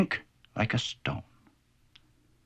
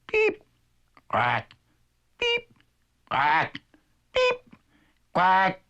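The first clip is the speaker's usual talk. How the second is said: chick and duck calls; 7 semitones higher, much slower than usual. Acted. Chick and duck trading peeps and quacks. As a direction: trade peeps and quacks back and forth.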